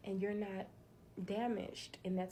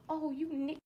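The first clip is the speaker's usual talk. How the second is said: higher, louder mocking jeer; online taunt; 7 semitones higher, 3 dB louder than usual. Strangers on headsets in an online game, higher louder mocking jeer. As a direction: toss it out like a game-chat troll. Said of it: "people will say".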